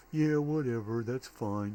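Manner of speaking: usual speaking voice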